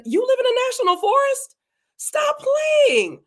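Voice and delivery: high-pitched astonished question, then falling; amazed disbelief. Real, not acted. Host learns a viewer lives in a national forest, amazed. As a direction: react in real, squealing disbelief.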